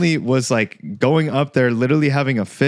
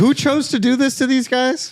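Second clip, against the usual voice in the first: high, bewildered mock Hitler; baffled Hitler voice; 11 semitones higher than usual. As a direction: play him clueless and shocked. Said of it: "He's like".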